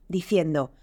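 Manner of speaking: ordinary delivery, nothing marked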